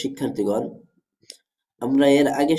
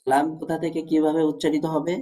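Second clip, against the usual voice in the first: ordinary talk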